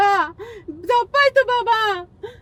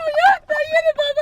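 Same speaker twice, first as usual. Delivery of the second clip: tearful, pitched-up wail of begging; crying plea; 6 semitones higher than usual. Acted. Frightened mother begging the fake baba, crying. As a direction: cry out for him to take it off. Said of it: "ಅಳಬಾರ್ದು, ಅಳಬಾರ್ದು".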